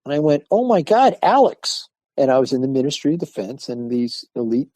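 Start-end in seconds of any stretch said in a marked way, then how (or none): 0.5-1.9 high, loud gasp of surprise; stunned surprise. Retold or imitated. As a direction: blurt it out, amazed. Stunned at seeing his old student.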